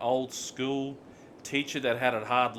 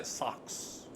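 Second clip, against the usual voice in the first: Kiwi vowel turning "six" into "sucks"; mock Kiwi "sucks" for six; New Zealand accent; 6 dB quieter than usual. Shown as a demonstration. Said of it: "they'll say sucks".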